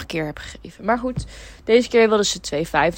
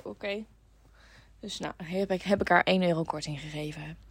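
ordinary talk

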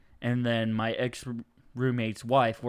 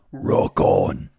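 raspy voiceless demon growl; gravelly demon sign-off; 6 semitones lower, 5 dB louder than usual. Acted. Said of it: "Can you do the demon voice".

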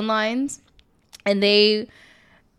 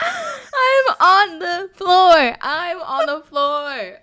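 laughing; 8 semitones higher, much slower than usual. Real, not acted. Laughing hard at the joke.

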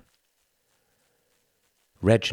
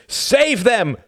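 very high, loud command; desperate shout; 9 dB louder than usual. A narrator's reading. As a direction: scream it over the crowd. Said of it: "shouted Tom".

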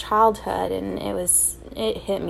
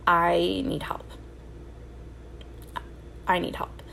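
quiet plea; 2 semitones lower, 7 dB quieter than usual. Retold or imitated. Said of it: "was like".

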